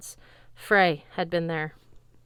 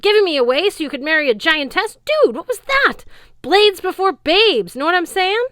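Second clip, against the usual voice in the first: outraged yell; 12 semitones higher, 11 dB louder than usual. Acted. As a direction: rant at the father who traded him away. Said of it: "he yelled".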